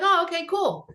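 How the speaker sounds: high casual surprise. Retold or imitated. Telling a fan panel how she took the booking call, in a high offhand voice. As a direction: toss it off high and light, faking cool. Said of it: "I'm like".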